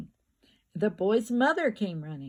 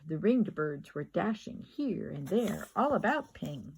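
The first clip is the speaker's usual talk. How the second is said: ordinary delivery, nothing marked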